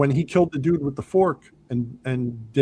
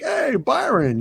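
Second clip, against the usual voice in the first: mocking call; 12 semitones higher, slower than usual. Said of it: "And then Ben Wade's like".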